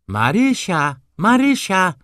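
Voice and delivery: loud, high summons, rising on the repeat; impatient shout for a servant, pitch way up; calling out. A narrator's reading. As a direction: call the maid insistently from afar. Mistress calling the maid again.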